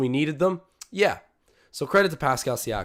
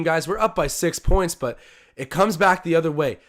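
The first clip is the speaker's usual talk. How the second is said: ordinary delivery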